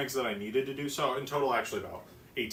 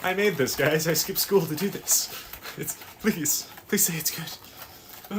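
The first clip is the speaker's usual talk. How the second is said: louder, higher playful begging; mock desperate pleading; 4 semitones higher, 7 dB louder than usual. Baker pleads for praise of cake, mock desperation. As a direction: beg with hammed-up desperation.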